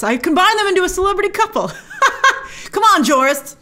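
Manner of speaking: high-pitched, real giggly amusement; laughing at herself. Real, not acted. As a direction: laugh through it at your own slip. Laughs at own name slip.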